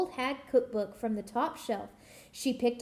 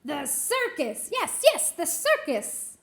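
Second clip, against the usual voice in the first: much higher and louder yes yes; excited witch; 5 semitones higher, 5 dB louder than usual. Acted. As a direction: burst out with glee.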